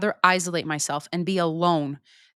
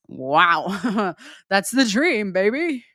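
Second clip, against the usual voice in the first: laughing; 3 dB louder, much slower than usual. Real, not acted. Dreaming aloud of ideal man.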